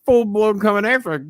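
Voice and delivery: ordinary delivery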